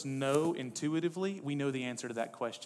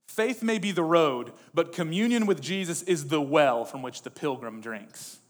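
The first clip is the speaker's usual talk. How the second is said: preacher declaiming a quote; 4 semitones higher, 11 dB louder than usual. Acted. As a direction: proclaim it to the whole congregation.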